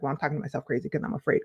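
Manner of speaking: low, quick, soft admission; answering oneself. Acted. Answering her own question about fear, low quick self-talk. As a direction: answer yourself under your breath, quickly. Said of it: "You're just like".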